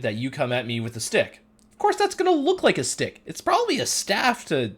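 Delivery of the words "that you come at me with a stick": ordinary talk, nothing marked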